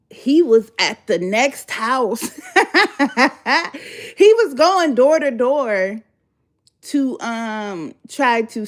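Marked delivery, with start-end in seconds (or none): 2.5-6.0 laughing through the words; cracking up, higher and louder; can't hold the laugh in. Real, not acted.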